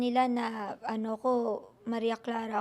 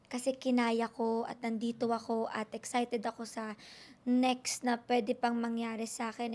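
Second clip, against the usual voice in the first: ordinary delivery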